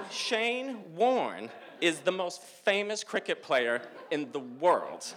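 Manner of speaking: slow, high-pitched, each phrase stretched; emphatic declaration. Retold or imitated. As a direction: spell it out slowly in disbelief. Friends spell out his fame.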